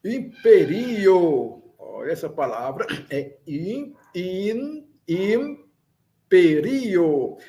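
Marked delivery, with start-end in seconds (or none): 0.0-1.6 Imperio with the RI long and strong; slow Imperio, leaning on the penultimate syllable; stress on second I. Shown as a demonstration. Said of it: "A sílaba mais forte está no segundo I". 4.1-7.4 imperio broken after im, RI stressed; stress on second I. Shown as a demonstration. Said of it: "A sílaba mais forte está no segundo I".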